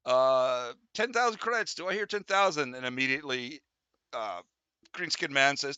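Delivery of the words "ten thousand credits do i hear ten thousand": higher, ringing auctioneer call. Acted. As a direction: call for the first bid. GM calls the opening bid.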